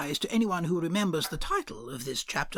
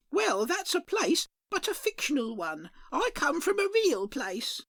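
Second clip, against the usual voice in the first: high, sing-song dearie voice; a chirpy shopkeeper greeting at the bell; 10 semitones higher than usual. Acted. Shopkeeper greets a customer, high and sing-song.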